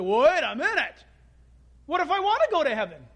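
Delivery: high-pitched, urgent interruption; mock alarmed objection. Listener afraid of missing heaven interrupts preacher, mock alarm. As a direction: burst in as a worried objector, acted up.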